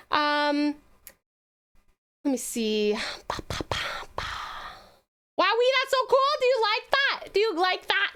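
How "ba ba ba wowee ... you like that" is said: ordinary talk, nothing marked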